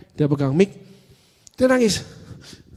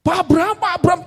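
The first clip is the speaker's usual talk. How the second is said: high, urgent summons from afar; imitated calling out; 11 semitones higher than usual. Worship team calls preacher from the hall, imitated shout. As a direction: call the name in a much higher voice. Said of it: "teriak itu dari ruang ibadah".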